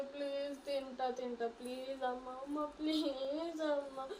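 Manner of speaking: soft, slow tearful pleading; begging. Acted. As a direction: promise to eat, begging softly. Daughter begs, promises to eat. (తింటానని అమ్మను బతిమాలే కూతురు, మెల్లగా నెమ్మదిగా)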